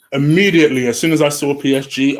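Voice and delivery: usual speaking voice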